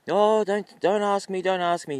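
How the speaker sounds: high, loud, repeated protest; alarmed pleading. Acted. Old man refusing to tell wife his secret, alarmed. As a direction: fend off the question urgently. Husband fends off wife's questions.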